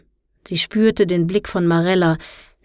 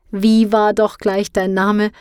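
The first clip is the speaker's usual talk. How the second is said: slow, louder, amused teacher; 3 semitones higher, 4 dB louder than usual. A narrator's reading. Teacher asking the embarrassed girl's name, amused. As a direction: drawl the question with a grin. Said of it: "fragte er".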